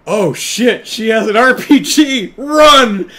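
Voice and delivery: loud, high, panicked shout rising to a scream on run; high frantic yell. Retold or imitated. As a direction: yell it like a thug fleeing in terror. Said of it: "you hear one of the bad guys yell".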